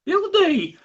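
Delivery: high excited squeal of a name. Acted.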